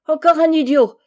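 loud, high, scornful exclamation; princess's contemptuous outburst; exasperated cry. A narrator's reading. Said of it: "s'écria la princesse".

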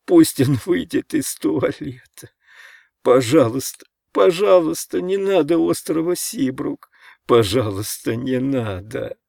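put-on plaintive little-boy pleading; pleading in sleep. A narrator's reading. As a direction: beg feebly, as if caught in a nightmare. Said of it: "бормотал Ричард во сне".

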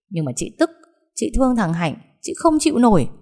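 put-on sobbing like a child. A narrator's reading. Hoa sobs to her sister, childlike and upset. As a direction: break down like a hurt child. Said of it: "Hoa ấm ức như một đứa trẻ con".

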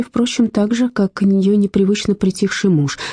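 usual speaking voice

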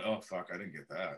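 low, softened disappointed mutter; dejected aside. Retold or imitated. Actor leaving the audition, muttering in defeat. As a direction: drop pitch and volume, mock defeat.

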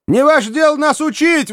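very high, defiant outburst; indignant exclamation. A narrator's reading. Archer rebuffing the provost, indignant exclamation. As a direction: cry out in protest, pitch soaring. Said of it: "воскликнул Каннингем".

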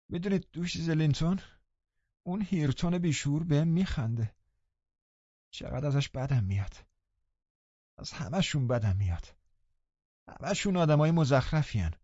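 high, soft, sullen whining; sulky sickly boy. A narrator's reading. Linton sulking to the housekeeper, high and whiny. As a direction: grumble like a spoiled invalid.

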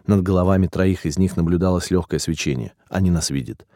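ordinary delivery

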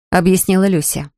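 ordinary delivery

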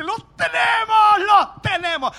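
high, slow, loud triumphant devil shout; the devil shouting in triumph over a quarrelling couple; gloating devil. Acted. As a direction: crow it like the devil who won. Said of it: "El diablo dice".

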